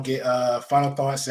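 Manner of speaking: ordinary delivery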